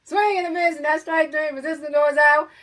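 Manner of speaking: loud, high strikeout call; excited play-by-play. Acted. Announcer calling ten strikeouts for the crowd, loud and high.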